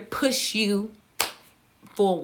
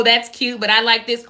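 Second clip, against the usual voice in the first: ordinary delivery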